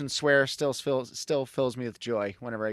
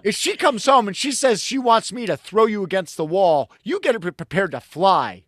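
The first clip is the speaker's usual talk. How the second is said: loud laughing mock threat; half-laughing stern warning, loud; father's warning; 6 semitones higher, 7 dB louder than usual. Father telling the kids they're on their own, loud laughing mock threat. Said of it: "he's like".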